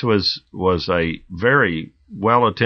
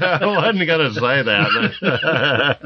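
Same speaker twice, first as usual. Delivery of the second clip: laughing; 4 semitones higher, much slower than usual. Real, not acted. Laughs at Deputy Dog remark.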